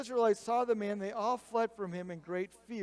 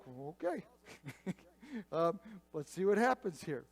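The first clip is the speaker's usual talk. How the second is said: mock reluctant okay; doubtful concession. Acts out Saul's hesitant giving in.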